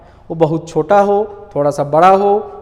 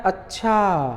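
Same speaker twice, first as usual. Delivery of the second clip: softer अच्छा with a long falling end; dawning understanding; 5 dB quieter, much slower than usual. Shown as a demonstration. Teacher showing you have understood, drawn-out अच्छा. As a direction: draw out the last vowel of अच्छा as it sinks in. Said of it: "आपको समझ में आ गया".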